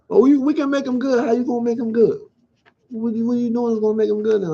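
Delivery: sarcastic impression of the suits; scoffing parody of management; mocking front office.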